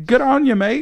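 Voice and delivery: cheeky put-on Irish; Irish accent. Host greeting Irish fans in a mock Irish accent. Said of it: "I don't wanna butcher an Irish accent".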